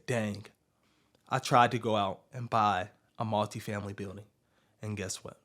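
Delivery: low, soft, deflated mock complaint; discouraged. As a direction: act out someone quietly giving up. Voicing people who give up.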